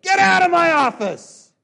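high, loud angry shout; yell. Retold or imitated. Father yelling at his kids to leave, full angry shout. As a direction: blast it out in anger. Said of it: "and I yelled, and I said".

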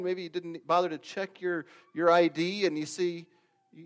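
normal talking voice

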